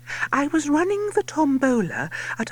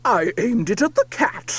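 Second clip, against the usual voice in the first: lord crying out; 4 dB louder than usual. A narrator's reading. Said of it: "He cries".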